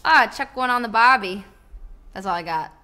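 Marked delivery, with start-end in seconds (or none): 0.0-1.4 loud, hammed-up Aussie accent; cartoonish Australian accent, projected. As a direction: belt out a stock Australian accent. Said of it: "I can't do an Australian accent".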